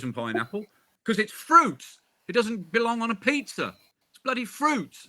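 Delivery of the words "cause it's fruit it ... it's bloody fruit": mock outrage. Comic rant against pineapple on pizza.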